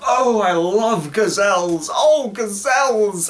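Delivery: slow, gushing mock adoration; hammed-up high swoon, loud and drawn out; gazelle fanatic. Gazelle-obsessed businessman declaring his love, gushing mockery. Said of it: "he's just like, he's like".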